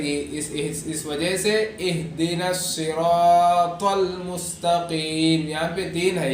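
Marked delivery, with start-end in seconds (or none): 1.8-5.5 slow, stretched اہدنا الصراط المستقیم; full tajweed model of اہدنا الصراط المستقیم; careful saad, taa, qaaf. Shown as a demonstration. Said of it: "ایک صاد کا لحاظ رکھنا ہے".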